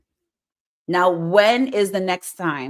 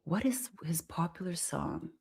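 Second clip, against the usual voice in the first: soft whispered question to self; 3 semitones lower, 13 dB quieter than usual. Real, not acted. Streamer wondering to herself in a whisper. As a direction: whisper it, thinking. Searching memory quietly.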